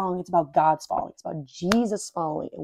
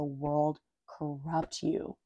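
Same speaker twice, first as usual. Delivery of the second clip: slowed ending; 4 semitones lower, 7 dB quieter, much slower than usual. Real, not acted. Slow emphatic end.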